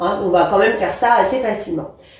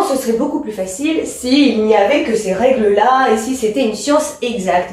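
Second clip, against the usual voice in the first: usual speaking voice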